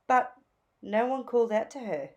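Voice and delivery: ordinary delivery, nothing marked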